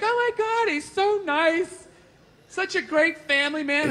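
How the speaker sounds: high, drawn-out swooning praise; gushing admirer. Retold or imitated. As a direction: mimic people fawning over a nice guy.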